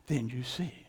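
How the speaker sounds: hushed, breathless whisper. Acted. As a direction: let it fall to a secret whisper. Preacher drops to a whisper.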